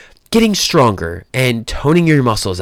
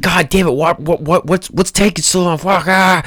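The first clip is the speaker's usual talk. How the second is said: frustrated outburst; 5 semitones higher than usual. Retold or imitated. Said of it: "I get frustrated 'cause I'm like".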